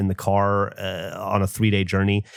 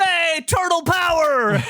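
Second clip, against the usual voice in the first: mock yell; slower than usual. Wife's misheard heckle, high slow yell. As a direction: belt it like a taunt. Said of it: "the guy yelled".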